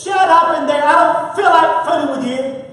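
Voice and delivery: loud, high, annoyed snap through the wall; mother woken at night yelling at her baby; irritated mother yelling. Retold or imitated. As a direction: yell at the crying baby to shut up. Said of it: "The loving mother says".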